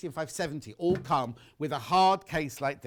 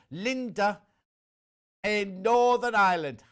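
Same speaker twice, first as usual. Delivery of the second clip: slow, loud put-on Northern Irish; Northern Irish accent; 3 semitones higher, 6 dB louder, slower than usual. Presenter reading Linda's sign-off, mock Northern Irish accent. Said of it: "That's my Northern Irish accent".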